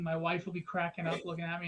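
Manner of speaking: normal talking voice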